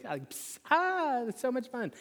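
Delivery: put-on squeaky high baby giggle; playful baby voice delight; imitating baby giggle. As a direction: mimic a toddler squealing with glee. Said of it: "and she giggles".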